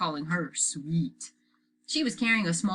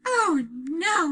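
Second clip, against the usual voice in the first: holding nose; 12 semitones higher, 6 dB louder than usual. A narrator's reading. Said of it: "She held her nose, too".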